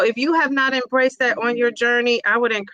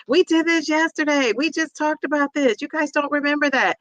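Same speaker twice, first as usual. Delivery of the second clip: higher, fed-up teacher voice; exasperated teacher; 6 semitones higher than usual. Retold or imitated. Teacher complaining to kids who forgot yesterday's lesson, exasperated. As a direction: sigh it at the class who forgot. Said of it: "I will admit".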